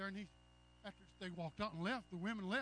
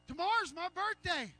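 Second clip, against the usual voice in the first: Agnes's line; 10 semitones higher, 6 dB louder than usual. Retold or imitated. Agnes announcing her birthday.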